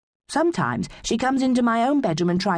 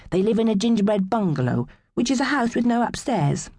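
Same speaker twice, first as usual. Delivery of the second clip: ordinary delivery, nothing marked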